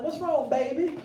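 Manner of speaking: put-on a parent soothing a baby at night. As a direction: coo it to the little one. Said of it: "you go in there like".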